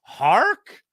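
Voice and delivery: high, loud, slow hammed-up alarm; mock shocked gasp. Podcaster acts his own alarm at the video, high, loud and slow. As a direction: ham up shock at a sound. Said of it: "And I went".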